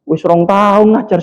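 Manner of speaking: ordinary talk, nothing marked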